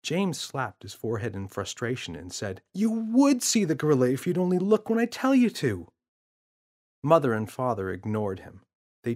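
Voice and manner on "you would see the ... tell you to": put-on frustrated boy, much higher; whiny kid pleading to be heard; boy's voice. James in frustration.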